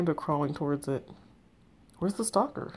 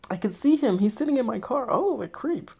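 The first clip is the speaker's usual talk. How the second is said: alarmed excitement; 7 semitones higher, 5 dB louder than usual. Acted. Viv spots the stalker in her car.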